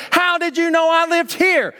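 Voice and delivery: the hitchhiker can't believe it; astonished hitchhiker. Retold or imitated. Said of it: "he goes".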